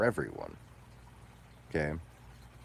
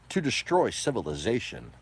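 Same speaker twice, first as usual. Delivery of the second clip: usual speaking voice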